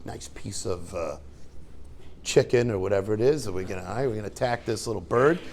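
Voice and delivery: lightly voiced snake sizing up a chick; sly snake. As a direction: purr it like a hungry snake eyeing lunch. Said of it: "the snake comes like".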